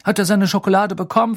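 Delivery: quick, high, worried question. A narrator's reading. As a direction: ask with anxious haste. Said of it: "fragte er besorgt".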